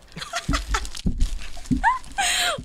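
high shrieking laugh. Real, not acted. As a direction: burst out laughing. Hearty laugh at own joke.

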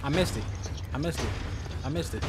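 low, soft muttering to himself; muttered. Real, not acted. Annoyed gamer mutters after missing the shot. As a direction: grumble it under your breath.

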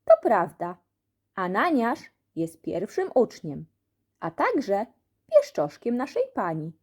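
plain reading voice

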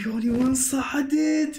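ordinary talk, nothing marked

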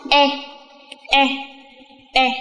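"e" drilled three times; teacher drilling a vowel sound; model vowel sound. Shown as a demonstration. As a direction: say "e" clearly, one at a time. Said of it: "Âm tiếp theo".

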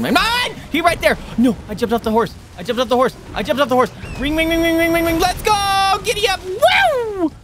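very high, loud panicked squeals; frantic shrieking. Real, not acted. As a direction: scream for the horse while fleeing. Fleeing bear, calling horse.

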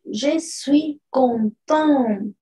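slow, careful "Je suis contente" with the final T sounded; French pronunciation. Shown as a demonstration. Teacher modelling French for the class, slow and careful. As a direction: spell out "contente" so the T is heard at the end. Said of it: "[name] nos dice".

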